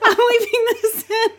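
high, loud laugh through words; can't stop laughing while talking. Real, not acted. Laughing at own mistake.

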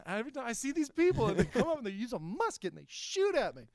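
high, fast comic complaint; hammed-up, high-pitched grievance; mock exasperated local. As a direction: whine it quickly in a put-on voice. Continues the exasperated local bit.

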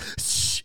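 unvoiced "psshh" of spraying water; imitated water jet. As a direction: make a sudden hissing spray noise. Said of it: "It was like".

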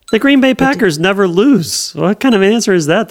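high outraged protest; indignant disbelief. Real, not acted. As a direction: scoff in disbelief. Indignant reaction to rival answer.

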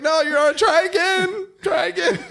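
high, loud laughing mimicry; mocking the kid. Dad echoing his son's wail, high and laughing. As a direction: parrot the kid's wail while laughing.

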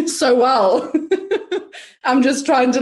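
laughing through the words. Real, not acted. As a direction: giggle as you admit it. Laughs at own trauma joke.

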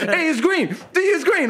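high, urgent status calls; mock crew shouting reports back; crewmen reporting. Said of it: "felt like we were on a ship".

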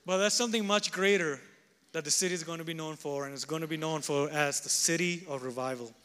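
preaching with rising force; full-voiced pulpit proclamation; fervent preaching. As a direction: preach it out to the congregation.

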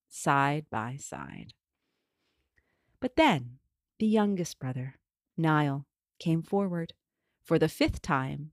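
ordinary delivery, nothing marked

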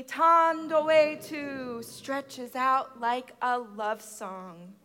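ordinary delivery

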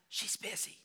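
put-on hushed little boy; whispering child. Jimmy whispering to the pastor on the phone. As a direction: keep it secretive and small. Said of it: "a voice answered with a whisper".

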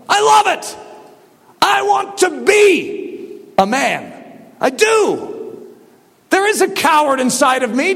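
high, fervent pulpit confession; impassioned preaching. Real, not acted. Preacher confessing the coward inside, impassioned. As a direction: declare it with rising fervor.

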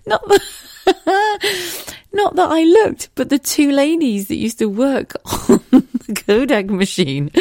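high giggling denial; laughing. Real, not acted. Laughing as she denies looking at the photos.

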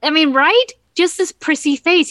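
prissy face. Retold or imitated. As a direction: put on a mock prim, prissy face as you say it. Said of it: "impertinent, prissy".

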